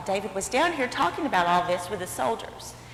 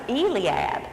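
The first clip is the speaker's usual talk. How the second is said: ordinary delivery, nothing marked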